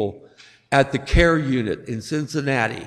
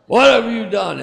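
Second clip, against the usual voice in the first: higher-pitched imitation of a mother's scolding; 5 semitones higher than usual. Mother catching her son doing something bad, imitated scolding. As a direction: call out the full name like an outraged mom. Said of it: "My mother did that with me".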